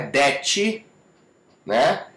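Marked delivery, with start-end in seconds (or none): 0.1-0.8 "that" said as "detchi"; learner's wrong "that", with D and a "tchi" ending; mispronounced D. Shown as a demonstration. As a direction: Brazilian added vowel ending "that". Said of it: "Não é".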